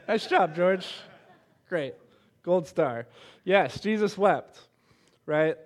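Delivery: gushing over a student like a teacher handing out gold stars; high school teacher praise. Retold or imitated. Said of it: "so I'm like".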